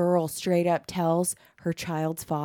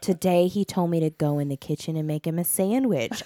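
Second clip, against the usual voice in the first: normal talking voice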